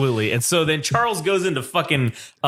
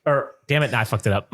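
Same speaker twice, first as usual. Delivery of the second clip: ordinary delivery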